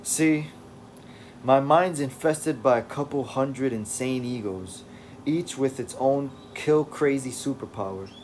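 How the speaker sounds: put-on loose British accent for David; attempted British accent. David telling the reader about his mind, in a rough British accent. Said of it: "I should be speaking with an English accent".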